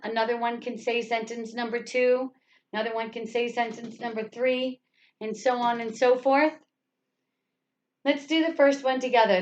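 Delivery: ordinary talk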